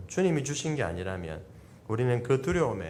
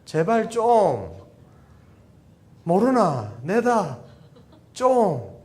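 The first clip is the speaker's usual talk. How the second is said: loud, high Gyeongsang pleading, drawn out on 제발 좀; pleading Gyeongsang dialect; 6 semitones higher, 9 dB louder, much slower than usual. Shown as a demonstration. Pastor voices God begging in Gyeongsang dialect, loud and high. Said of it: "우리 경상도 말에는 이 좀 한마디에".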